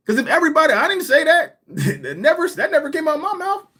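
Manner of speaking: high, loud, laughing protest; excited denial. Real, not acted. Denying to the guest he said it, high excited protest. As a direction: insist it never came out of your mouth.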